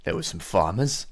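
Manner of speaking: ordinary talk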